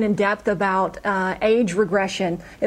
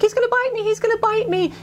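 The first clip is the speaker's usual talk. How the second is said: put-on high, panicky little-girl pleading; scared child; 11 semitones higher than usual. Client reliving childhood fear of a hamster, high and scared. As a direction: voice a frightened five-year-old, pitched way up.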